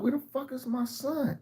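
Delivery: high, thin, panicky voice; panicked squeal. Retold or imitated. Father searching for his missing son, high panicked squeak. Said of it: "I'm like".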